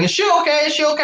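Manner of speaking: high, quick worried questioning; concerned onlookers. Retold or imitated. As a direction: imitate bystanders asking in concern. Onlookers retold asking after her.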